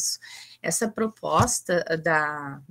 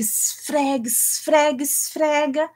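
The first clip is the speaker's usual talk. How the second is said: each esfrega higher than the last; building scrub; 7 semitones higher, slower than usual. Acted. Scrubbing repeated in poem. (esfrega cada vez mais alto e animado)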